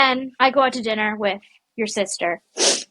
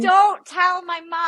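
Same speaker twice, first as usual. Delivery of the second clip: mock pleading; 7 semitones higher, slower than usual. Pleading with the friend.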